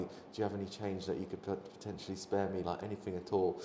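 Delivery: quiet, polite plea for change; speaking very quietly. Retold or imitated. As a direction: murmur the request to a small group. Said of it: "speaking very quietly".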